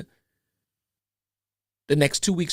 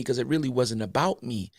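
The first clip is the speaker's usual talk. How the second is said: ordinary talk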